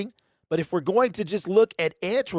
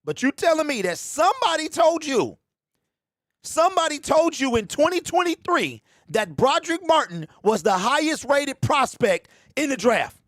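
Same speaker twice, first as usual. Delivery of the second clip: incredulous; 8 semitones higher than usual. Real, not acted. Mocking the GM's claim.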